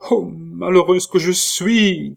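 high, loud, slow wail of despair; anguished cry. A narrator's reading. Catherine despairing as her lover must leave, high anguished cry. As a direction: cry out your misery. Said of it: "cria Catherine".

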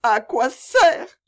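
slow, high, loud bitter wail; bitter sobbing. A narrator's reading. Mother refusing the elder's comfort, bitter tearful cry. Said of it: "dit-elle en sanglotant avec amertume".

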